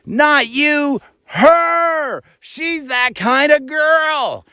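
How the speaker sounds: high mock yell, slow on the last words; angry woman. Woman in truck shouting at the passenger, high mock yell. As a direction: pitch way up and holler the accusation.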